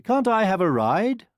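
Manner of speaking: put-on high, loud childish plea; plaintive protest; crying out. A narrator's reading. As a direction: plead like a disappointed prince. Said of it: "the prince cried".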